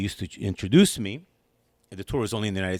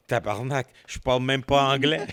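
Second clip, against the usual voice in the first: louder, exasperated Quebecois French; Quebecois accent; 4 semitones higher, 7 dB louder than usual. Retold or imitated. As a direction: imitate a small-town Quebecois protesting in French. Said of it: "can't understand his Quebecois".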